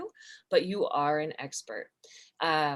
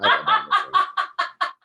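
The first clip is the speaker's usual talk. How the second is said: wife's laugh; 7 dB louder than usual. Retold or imitated. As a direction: do your wife's cackling laugh. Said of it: "That's the laugh that my wife gives".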